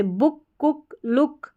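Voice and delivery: "बुक, कुक, लुक" each with a short u; short oo demo. Shown as a demonstration. Teacher reads double-o-k words to learners, short u in "बुक, कुक, लुक". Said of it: "short था double ओ का sound".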